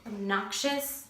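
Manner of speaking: ordinary talk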